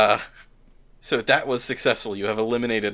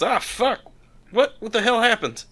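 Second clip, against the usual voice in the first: a cursing Southern thug who can't believe his broom; Southern accent; 11 semitones higher than usual. Acted. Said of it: "I don't know why everyone's Southern".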